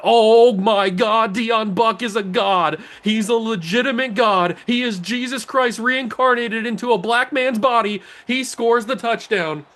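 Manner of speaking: high-pitched, stretched-out excited cheering; ecstatic hype. Real, not acted. Player hailing his kick returner's touchdown, ecstatic. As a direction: rave over the star returner as he runs.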